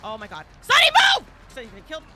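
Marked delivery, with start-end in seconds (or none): 0.6-1.3 high, loud yell to move; panicked high shout; shouting a warning. Real, not acted. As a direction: scream a warning at your teammate. Yelling at teammate to move.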